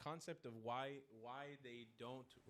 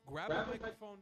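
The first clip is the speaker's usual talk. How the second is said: a rousing call to arms, higher and louder; rally every viewer to grab a camera; rallying war cry; 5 semitones higher, 6 dB louder than usual. Real, not acted. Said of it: "this is a war cry".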